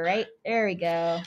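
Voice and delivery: ordinary talk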